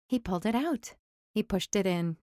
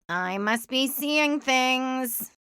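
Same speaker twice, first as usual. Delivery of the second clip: slow, dazed drawl; drawling man; 4 semitones higher, much slower than usual. A narrator's reading. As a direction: disbelieve it slowly. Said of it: "Otis chimed in".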